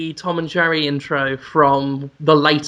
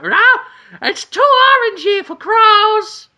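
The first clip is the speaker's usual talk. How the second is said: high, slow, laughing quote of the cartoon kid; advert kid voice; 4 dB louder, slower than usual. Quoting the ad to a friend, high laughing cartoon voice. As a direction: ham up the old ad line with a laugh. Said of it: "about five listeners will get that".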